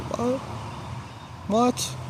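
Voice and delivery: a high disbelieving cry of What; shocked mom. Retold or imitated. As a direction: imitate mom's shocked squeak. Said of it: "And she said".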